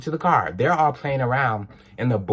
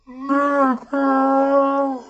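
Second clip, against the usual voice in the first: high, far-off hollered slur; mock holler; 4 dB louder than usual. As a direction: imitate the boys yelling from the car. Voicing the hollered slur from car.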